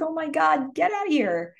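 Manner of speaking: high-pitched imitated panic; mock terrified. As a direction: act out a frightened friend's urgent warning, pitched high. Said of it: "Emma's terrified".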